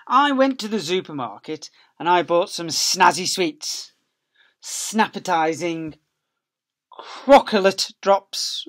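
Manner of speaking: high, loud, slow storybook voice; reading to children. Acted. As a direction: read it out big and lively for little ones. Reading the picture book aloud.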